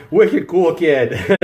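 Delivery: slow, laughing mock Boston accent; drawn-out bad Boston slang with a laugh; goofy put-on Boston accent; terrible Boston accent. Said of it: "my terrible Boston accent".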